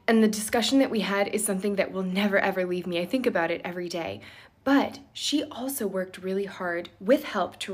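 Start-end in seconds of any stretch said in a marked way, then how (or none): none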